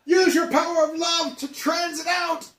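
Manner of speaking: high, loud, laughing mock shout; over-the-top hero plea. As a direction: ham it up like a cartoon hero. Mock epic plea to Dad.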